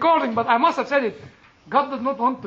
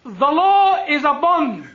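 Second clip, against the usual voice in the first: ordinary delivery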